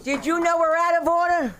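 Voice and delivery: high-pitched mock indignation; hammed-up outburst. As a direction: pitch it up and challenge the whole room. Hammed-up challenge to the room.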